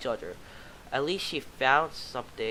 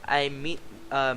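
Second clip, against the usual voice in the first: ordinary delivery, nothing marked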